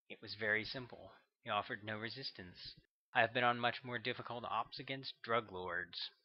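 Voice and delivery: ordinary delivery, nothing marked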